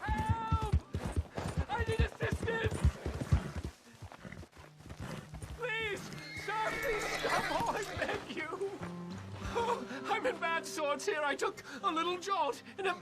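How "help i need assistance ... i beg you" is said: high desperate cries for help; calling for help. Acted. Lost woman calling for help.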